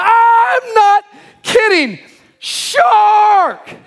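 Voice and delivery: very high, slow, screamed in terror; terrified shout. Retold or imitated. Dakota insisting to friends he's not kidding, terrified scream. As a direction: drag it out like your life depends on it. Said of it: "sheer terror, fear, and panic".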